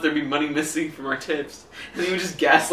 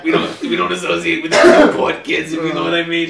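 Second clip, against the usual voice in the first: louder put-on snooty voice; mock snob; 7 dB louder than usual. Mocking Westport snobs, louder put-on voice. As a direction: look down your nose.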